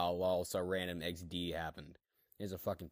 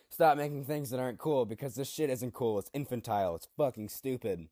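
ranting; 4 semitones higher, 6 dB louder than usual. Acted. Character's ranting manifesto climax.

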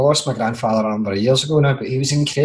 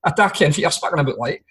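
fast, higher hammed-up Glaswegian banter; mock Glasgow accent; 7 semitones higher than usual. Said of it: "you're speaking in a Glasgow".

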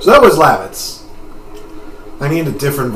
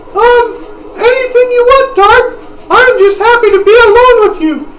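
put-on very high falsetto girl voice, louder than usual; sweet girl character; 8 dB louder than usual. Girl happy to be alone with Dart, high falsetto. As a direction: speak up high and girlish, pleased.

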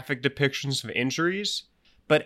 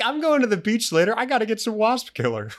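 mock shopper voice; 9 semitones higher than usual.

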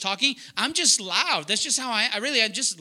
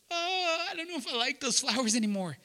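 a lightly voiced wife, softer and doubtful; picky wife; 4 semitones higher, 6 dB quieter than usual. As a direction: play the wife turning down the flowers, quietly. Pastor acting a picky wife.

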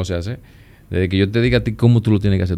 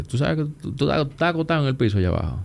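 higher, lightly voiced friend teasing; Víctor teasing; 7 semitones higher, 4 dB quieter than usual. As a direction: mimic a buddy ribbing you, pitched up and quick. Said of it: "me dice Víctor". (imitando a Víctor, voz más aguda y burlona)

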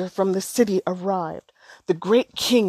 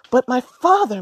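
a higher, louder, sugary plea to father; sweetly; 6 semitones higher, 7 dB louder than usual. A narrator's reading. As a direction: lay on the sweetness to win him over. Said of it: "Manyara said sweetly".

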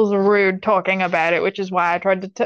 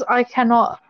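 ordinary talk

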